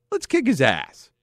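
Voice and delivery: high, eager mock battle cry; gung-ho. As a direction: imitate someone keen for a fight. Voicing the puncher's absurd thought.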